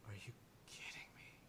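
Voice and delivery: hushed, whispered disbelief; appalled whisper. Acted. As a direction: whisper it as if you cannot believe it. Stunned Marvin voiced by game master.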